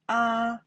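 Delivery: first-tone "ah", level throughout. Shown as a demonstration. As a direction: sustain "ah" on one steady pitch. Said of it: "The first time".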